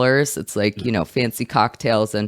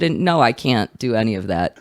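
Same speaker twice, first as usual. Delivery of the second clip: ordinary talk, nothing marked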